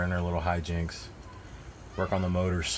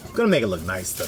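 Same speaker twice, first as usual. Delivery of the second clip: normal talking voice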